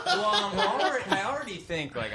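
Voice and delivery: ordinary talk, nothing marked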